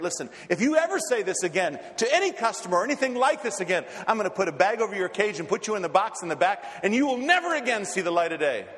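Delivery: mock-angry yell at a misbehaving parrot; yelling pet-store owner. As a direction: tell the parrot off, voice rising. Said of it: "he starts yelling at the parrot".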